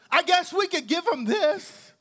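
smart aleck. Acted. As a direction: say it like a wisecracking know-it-all. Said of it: "as almost to be a smart aleck".

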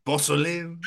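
put-on high-pitched French-accented word. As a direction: repeat it in a comic French accent. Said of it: "Porcelain and French accent really tickle me".

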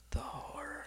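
breathy whispered movie quote; hushed whisper. Retold or imitated. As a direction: whisper it like a haunted man. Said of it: "what's the movie, Apocalypse Now?".

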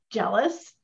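usual speaking voice

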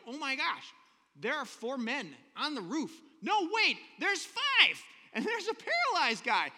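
mock astonished bystander; excited crowd. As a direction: gasp it out as a startled onlooker. Crowd exclaiming at roof intruders.

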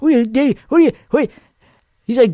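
ordinary talk, nothing marked

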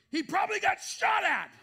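high, loud startled cry; alarmed outburst. Retold or imitated. Husband hears of the crash, loud alarmed outburst. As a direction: blurt it out in shock. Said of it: "I was like".

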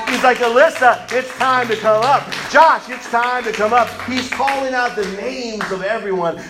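loud, high preaching voice, building; full-force preaching. Acted. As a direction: preach it out to the congregation.

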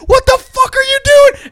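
screaming high in horror; horrified shout. Acted. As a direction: yell as the baby goes out the window. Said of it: "Here he says".

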